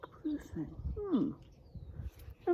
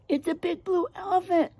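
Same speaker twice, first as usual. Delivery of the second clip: higher, silly animal voice; silly weasel; 8 semitones higher than usual. Acted. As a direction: sure of a wrong guess.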